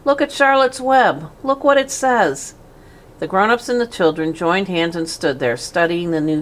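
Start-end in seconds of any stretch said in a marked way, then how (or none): 0.0-2.6 put-on high, eager girl's voice; excited girl. A narrator's reading. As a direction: point it out with childish delight. Fern excited at the web.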